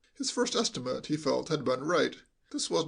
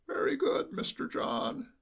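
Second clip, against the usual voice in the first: high, slow, quavering sheep-like bleat; an old servant bleating every word; bleating old butler; 10 semitones higher, slower than usual. Acted. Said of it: "bleated like a sheep".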